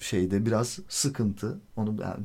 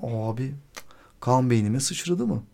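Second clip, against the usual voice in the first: ordinary talk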